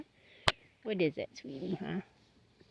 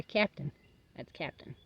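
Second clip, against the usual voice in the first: ordinary delivery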